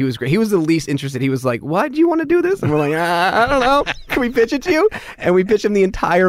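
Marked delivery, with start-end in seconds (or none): 1.6-2.6 high-pitched imitation of a doubter; mock a baffled star questioning you; skeptical questioning. Imitates uninterested actor questioning them. 2.9-4.9 high, unsure pleading, re-enacted; awkward pleading. Acts out awkward pitch request.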